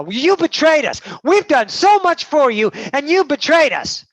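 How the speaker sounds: loud, high-pitched acted outburst; outraged accusation. Accusers confronting Roxanne over betrayal, outraged shouting. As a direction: hurl the accusation, wounded and loud.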